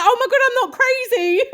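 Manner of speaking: high-pitched retold outburst; thrilled, high-voiced realisation; excited vindication. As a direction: squeal it like you've just been proved right. Retelling her excited discovery.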